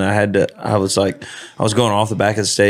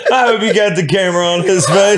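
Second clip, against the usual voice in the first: laughing; 9 semitones higher than usual. Real, not acted. Laughing at friend's reaction.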